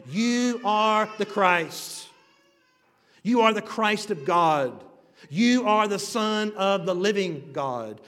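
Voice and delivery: loud, slow proclaiming of the words; fervent confession. Acted. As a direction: declare it like a solemn confession. Preacher voicing Peter's confession.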